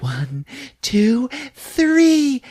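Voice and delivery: high count rising to a peak; excited countdown. Retold or imitated. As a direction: count down to a surprise, building. Wife counts down to surprise.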